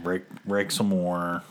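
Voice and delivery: usual speaking voice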